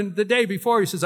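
ordinary delivery

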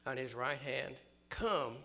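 loud, ringing sermon reading; preaching at full force. Acted. Preacher reading scripture to the congregation, booming. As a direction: proclaim it over the music.

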